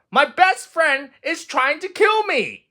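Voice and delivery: high, indignant complaint; dramatic Toad. A narrator's reading. Toad complaining about Frog dressing him, high and indignant. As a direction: ham up the outrage.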